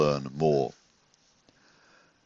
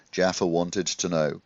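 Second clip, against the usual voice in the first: ordinary delivery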